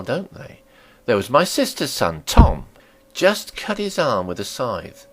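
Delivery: a landlady warming to her story; landlady telling a tale. Acted.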